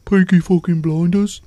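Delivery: put-on slow, lightly done Brummie accent on "Peaky fucking Blinders"; a mild Birmingham accent, drawn out. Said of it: "Toby did a better one".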